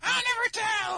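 very high, loud imp shriek; devilish taunt. Acted. Devil imp keeping his dish secret, shrieked taunt. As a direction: squeal it like a gleeful imp. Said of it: "the little panel of him like".

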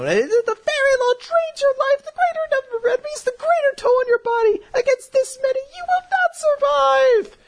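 shrieky mock-female falsetto; hammed-up, very high desperate pleading; frantic warning. Mavis warning him the spell kills, frantic falsetto.

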